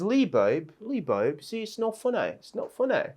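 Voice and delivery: mock British girlfriend, lightly done; British accent. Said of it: "she sounds very British in that way".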